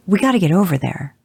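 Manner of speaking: urgent call to move out; yelling. A narrator's reading. Father shouts to the brothers to get over there fast. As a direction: raise the voice, urgent. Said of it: "Jensen yelled".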